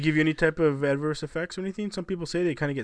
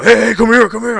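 calling over; 8 semitones higher, 15 dB louder than usual. Retold or imitated. Retold boss calling him over.

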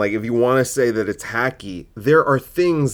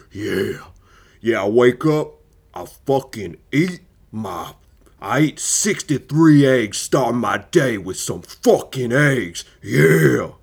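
loud, drawn-out macho man impression; mock a guy straining to sound manly; forced manly voice; 4 dB louder, slower than usual. Comedian mocking guys who talk tough, exaggerated manly voice. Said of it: "trying to prove how manly they are through their voice".

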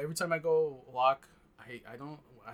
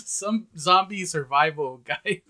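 laughing; 3 semitones higher, 11 dB louder, slower than usual. Real, not acted. Laughing about CDC guide.

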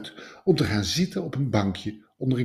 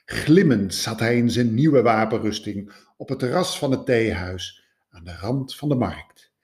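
ordinary delivery

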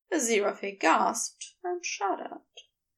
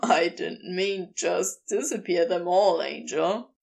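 amused; 6 semitones lower than usual. A narrator's reading. Crowley teasing his angel about vanished clothes, low and amused. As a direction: tease him in a lowered voice. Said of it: "and he chuckled".

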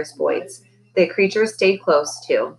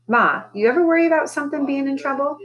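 high, tentative questioning; a child's worried night-time question; hesitant child; 8 semitones higher than usual. A narrator's reading. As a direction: ask Ma carefully, pitched up. Child anxiously questioning sleepy mother.

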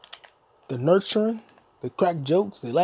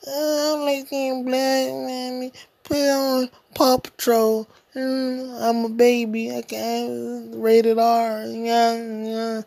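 put-on high, slow babyish whine; mock a spoiled child begging mommy; whiny kid voice; 7 semitones higher, much slower than usual. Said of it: "bitch-ass kids that can't handle".

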